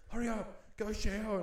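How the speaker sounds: high, urgent nagging voice. Retold or imitated. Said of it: "She's like".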